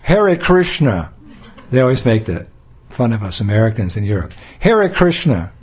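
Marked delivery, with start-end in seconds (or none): 0.0-1.1 put-on American accent. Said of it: "You have a funny accent". 4.6-5.5 put-on American accent. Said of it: "You have a funny accent".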